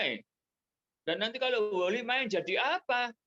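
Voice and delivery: teacher asking what Woli could play; surprised teacher. Retold or imitated. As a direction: sound taken aback, gently asking. Teacher answers Woli's mother.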